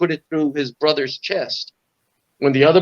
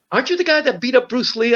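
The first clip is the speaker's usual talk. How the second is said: put-on awed kid; 9 semitones higher than usual. Kid recognizing him.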